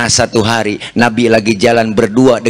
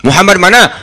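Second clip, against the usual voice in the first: higher and louder, calling from his bed; sick man calling out; 6 semitones higher, 4 dB louder, faster than usual. Acted. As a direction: call out suspiciously to the door. (orang sakit berteriak dari dalam kamar)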